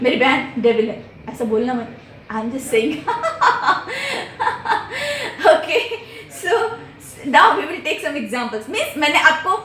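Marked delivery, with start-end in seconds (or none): 3.0-5.5 long high-pitched laugh; teasing laugh. Real, not acted. Teacher joking about devil sister, high teasing laugh. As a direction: laugh high at your own joke.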